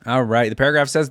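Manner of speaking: ordinary talk, nothing marked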